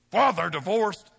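sniffy, loud put-down of the divorced; mock judgmental church member; mocking church gossip. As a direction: sneer it like a judgy churchgoer.

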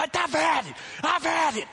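high, loud reenacted tirade; hammed-up, high-pitched angry outburst; mad rant. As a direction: rant like a dad pushed past his limit. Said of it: "I'm so mad".

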